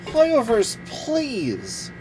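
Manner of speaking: usual speaking voice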